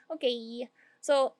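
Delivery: ordinary talk, nothing marked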